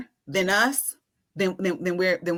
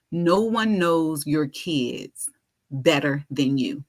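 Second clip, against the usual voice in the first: put-on "No one knows your kids better than you" spoken very slowly, each word weighed; slow deliberate quote; 5 semitones lower, much slower than usual. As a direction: lay it down slowly like wise advice. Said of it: "he goes".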